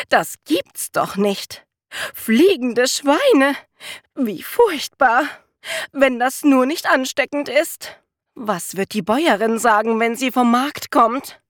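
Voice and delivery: a flustered maid wringing her hands in panic; alarmed maid. A narrator's reading. The maid at the window horrified by the flying pigs.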